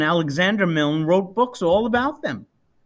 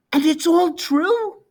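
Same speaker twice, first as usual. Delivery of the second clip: put-on higher child's voice, wide-eyed; little boy; 11 semitones higher than usual. A narrator's reading. Sleepy son asking his mother if it's true, higher childlike wonder. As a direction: ask it like an amazed small boy. Cole asks, amazed.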